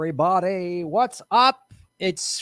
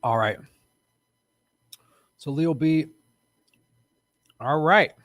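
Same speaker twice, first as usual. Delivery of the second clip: ordinary delivery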